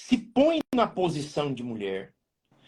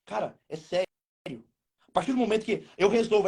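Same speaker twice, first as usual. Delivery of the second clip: normal talking voice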